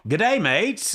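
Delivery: Australian greeting. Shown as a demonstration. Host greeting his Australian listeners in a mock Aussie accent. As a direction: try an Aussie accent on "Good day, mates". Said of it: "How do you say that?".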